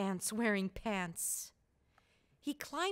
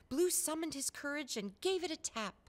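ordinary delivery

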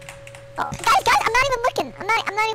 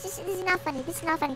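sulky mutter; 5 semitones lower, 10 dB quieter than usual. Real, not acted. Sulking after being mocked.

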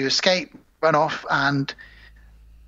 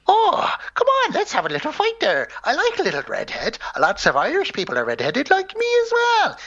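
high, lilting mock-Irish imp voice; Leprechaun; 12 semitones higher than usual. Leprechaun invites Chucky to a little fight, high mock-Irish voice. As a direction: put on a playful high Irish goblin. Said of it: "Leprechaun had all those funny lines".

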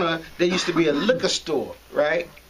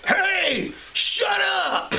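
cop yelling; 10 semitones higher, much slower than usual. Retold or imitated. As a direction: yell it across the street like a cop. Cop yells across street.

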